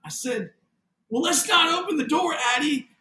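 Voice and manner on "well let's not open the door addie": high, loud nervous warning; retold freaking out. Father stops daughter opening the door, retold loud panic. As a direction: re-enact a father barely holding it together, voice shooting up. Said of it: "and I'm freaking out".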